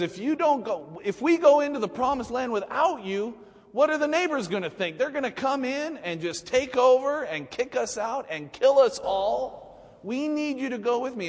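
high, loud, frantic pleading; anxious high-pitched plea; panicked Moses. Acted.

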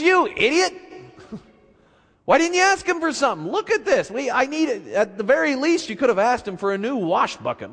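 high, exasperated nagging wife; fed-up wife voiced high and shrill-pitched; scolding wife. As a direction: berate him for missing his chance.

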